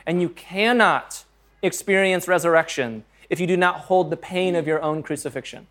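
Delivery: normal talking voice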